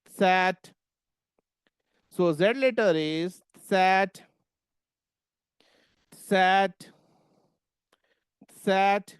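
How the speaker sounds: sat with a faint t before the s; German Z as tsat. Shown as a demonstration. Teacher showing the class how to say Z. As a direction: start sat with a hint of t, then the s. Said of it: "थोड़ा सा ता का sound शुरू में".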